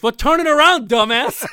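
high, loud comic comeback; shouted punchline. Acted. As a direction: belt out the punchline, laughing at the end. Shouted punchline of his joke.

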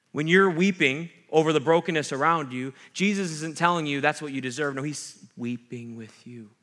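normal talking voice

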